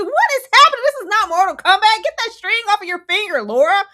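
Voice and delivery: high, loud, fast scolding; alarmed shout. Retold or imitated. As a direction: yell at her to snap out of it. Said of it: "I said".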